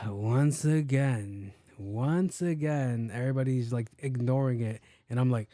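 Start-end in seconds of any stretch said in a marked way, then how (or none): 0.1-3.1 very slow, tired repetition; weary drawl. Acted. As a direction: drag it out like you've seen it all. Weary sigh before repeat.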